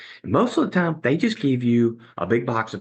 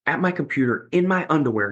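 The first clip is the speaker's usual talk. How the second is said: ordinary delivery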